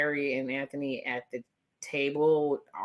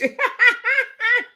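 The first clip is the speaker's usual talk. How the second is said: squealing laugh, pitched way up; high burst of laughter; 5 dB louder than usual. Real, not acted. Laughing at her own Lily Eilish joke, a high squeal. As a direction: let out a high cackle.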